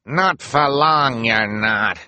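slow, loud villain snarl. Acted. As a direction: snarl it out slowly with menace. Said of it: "Esme snarled".

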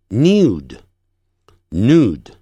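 first nude "nyood", second "nood"; slow contrast of "nude", glide then none; British, then American. Shown as a demonstration. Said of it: "再把这几组字念一下". (先英式后美式念nude)

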